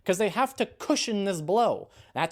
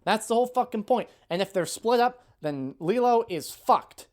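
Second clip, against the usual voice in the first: ordinary talk, nothing marked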